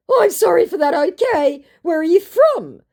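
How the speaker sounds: very high, loud, silly scammer; hammed-up high-pitched chat-up; put-on scammer voice. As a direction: play an overeager scammer up high. Voicing the second scammer.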